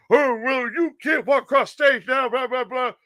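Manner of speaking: mock scolding. Storyteller mimics staff punishing the kids, mock scolding. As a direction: rattle off a mocking telling-off.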